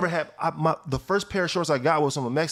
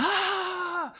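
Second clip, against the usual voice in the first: surprised yelp; 4 dB quieter than usual. Retold or imitated. Reacting to the paper.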